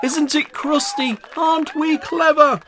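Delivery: very high, loud proud cheer; mighty shout. A narrator's reading. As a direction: crowd crowing with delight. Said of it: "raised a mighty shout".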